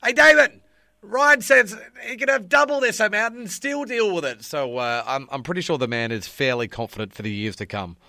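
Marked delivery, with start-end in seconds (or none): 0.0-4.4 higher, loud called-out shout; yelling across a room. Retold or imitated. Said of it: "yelling out during the draft".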